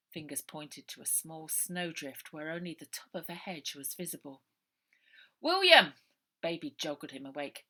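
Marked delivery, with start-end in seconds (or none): 5.4-5.9 loud high call of a name; sharp loud wake-up call. A narrator's reading. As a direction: shout to wake him. Baby waking William.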